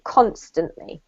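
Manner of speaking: constantly with CON stressed, falling; stress on first syllable. Shown as a demonstration. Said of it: "emphasis on the first syllable".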